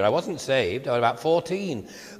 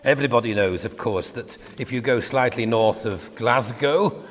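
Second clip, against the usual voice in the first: ordinary delivery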